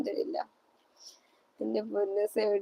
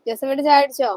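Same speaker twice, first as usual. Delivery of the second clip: calling the question out louder, higher; asking Josephetta about tea, called out louder; 4 semitones higher, 8 dB louder, much faster than usual. Real, not acted. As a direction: call it across to Josephetta. Calls question out to viewer.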